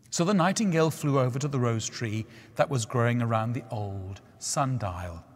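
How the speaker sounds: usual speaking voice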